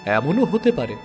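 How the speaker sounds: plain reading voice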